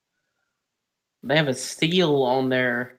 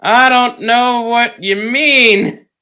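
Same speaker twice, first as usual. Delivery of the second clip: high, loud, drawn-out denial; feigned innocence; 6 semitones higher, 4 dB louder, slower than usual. Pretend. Teased friend denying a bias, exaggeratedly innocent. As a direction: protest your innocence far too much.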